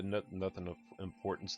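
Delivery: ordinary talk, nothing marked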